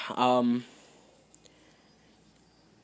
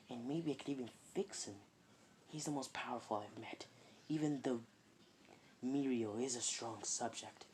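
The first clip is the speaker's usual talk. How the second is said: inner thoughts; 3 semitones lower, 16 dB quieter than usual. Acted. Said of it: "process of thinking is like".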